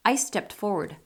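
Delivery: ordinary delivery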